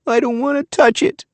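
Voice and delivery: put-on high little-girl plea; childlike, scared refusal. A narrator's reading. Girl refusing to touch fallen chicken, scared. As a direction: shrink from it, pleading.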